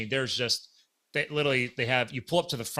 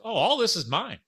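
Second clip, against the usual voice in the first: higher-pitched retold Southern accent; heavy Southern accent; 8 semitones higher than usual. Volunteer pointing out his gear, Southern accent. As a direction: lightly imitate a proud Southern volunteer. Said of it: "heavy Southern accent".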